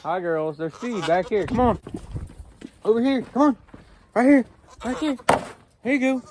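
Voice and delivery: high coaxing voice to livestock; coaxing animals. Real, not acted. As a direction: lure them over to the feed. Coaxing goats to feed.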